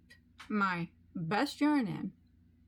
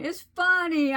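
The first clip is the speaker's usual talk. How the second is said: laughing; 8 semitones higher, 8 dB louder than usual. Real, not acted.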